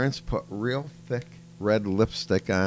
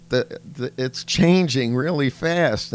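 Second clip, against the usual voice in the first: higher, louder mock child voice; a hammed-up schoolgirl presenter, pitched up; imitating a little girl; 5 semitones higher, 6 dB louder than usual. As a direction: play the kid doing a grown-up speech, higher and louder. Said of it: "And she goes".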